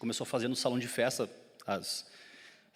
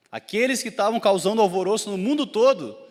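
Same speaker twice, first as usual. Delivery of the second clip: higher, louder accusation; mock alarmed outcry; 6 semitones higher, 8 dB louder than usual. Crowd warning officials about the troublemakers, loud and high. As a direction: raise an alarm as the retold crowd.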